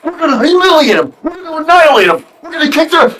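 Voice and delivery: imitating a jacked-up captain's rant; fired-up pep talk. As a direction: whip the team into a frenzy. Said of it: "And he's like".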